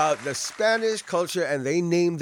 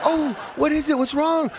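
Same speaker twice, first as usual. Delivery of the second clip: high, urgent bystander questions; mock alarm; 10 semitones higher than usual. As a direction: react as alarmed passers-by rushing to help. Bystanders alarmed by cry for help.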